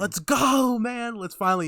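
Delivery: high, loud opening, easing off; rally a buddy for adventure; pumped-up hurrah; excited cheer. Retold or imitated. Cheering brothers' journey together.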